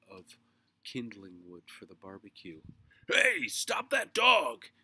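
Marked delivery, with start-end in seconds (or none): 3.1-4.7 high, drawn-out, very loud shout; alarmed yell. A narrator's reading. Mister Grumby seeing the dog take the roast, yell. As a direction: bellow it slowly across the yard. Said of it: "he yelled".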